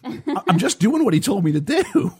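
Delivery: high, protesting mock-defensive voice. Host quoting the actor blaming his coach, high mock protest. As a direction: plead innocence in a high, comic whine.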